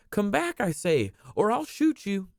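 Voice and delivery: put-on high, threatening little boy; angry child. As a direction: demand it like a cross child.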